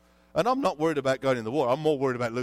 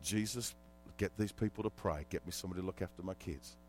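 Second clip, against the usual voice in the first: much softer, low plea to Jesus; desperate quiet prayer; 7 semitones lower, 11 dB quieter, faster than usual. Retold or imitated. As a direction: pray it under your breath. Prayer in crisis.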